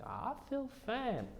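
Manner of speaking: put-on high, slow old lady voice; high and drawn out like grandma; imitating grandmother. Voicing grandmother Cookie.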